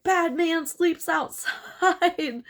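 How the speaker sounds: high, slow, odd simple-minded voice; childlike decree. Retold or imitated. Toffler banishing the man outside, high and slow. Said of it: "And Toffler's like".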